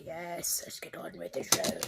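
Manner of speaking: hushed, fast and low; low quick whisper. Pretend. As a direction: rush it under your breath. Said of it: "I'm like".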